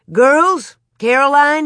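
loud, high, eager call. A narrator's reading. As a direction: summon the family with good news. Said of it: "he called".

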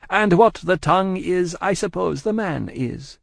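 high-pitched Madame, measured report; Madame, cool shopkeeper. A narrator's reading. Madame tells the spy of lapsed letters, high and measured.

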